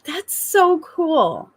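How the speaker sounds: high, drawn-out marvelling; impressed delight. Retold or imitated. Mom replying to her little girl, delighted. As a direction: gush in wonder, slowly.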